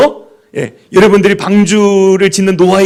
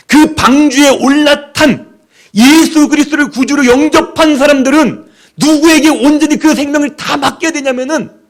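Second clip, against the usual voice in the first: higher, louder sermon build; impassioned preaching; 8 semitones higher, 4 dB louder than usual. Acted. Preacher urging the congregation, higher and louder. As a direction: drive it home from the pulpit.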